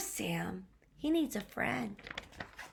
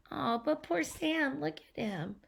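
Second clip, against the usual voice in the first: ordinary delivery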